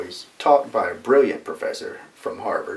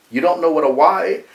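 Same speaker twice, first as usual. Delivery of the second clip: loud, higher incredulous Bostonian demand; livid; 8 semitones higher, 8 dB louder than usual. Retold or imitated. Bostonian professor to the puzzled student, livid. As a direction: imitate an outraged professor, R dropped on "wa". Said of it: "he became livid".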